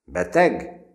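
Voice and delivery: high, loud curt query; stern curt question; hard question. A narrator's reading. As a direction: ask it coldly. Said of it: "megkeményedett arccal".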